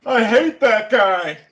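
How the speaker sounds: loud, high, slow proclamation; mock confiding shout. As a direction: act out a secret blurted at full volume. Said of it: "You just lean into your friend".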